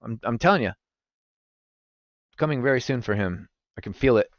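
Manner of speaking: ordinary delivery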